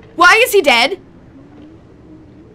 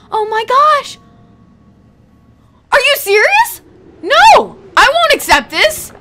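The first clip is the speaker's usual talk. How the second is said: shocked yelling; 9 semitones higher, 4 dB louder than usual. Real, not acted. Shocked at character death.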